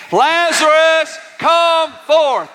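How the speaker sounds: high, stretched-out commanding call; like you mean it. Acted. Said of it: "Say it like you mean it".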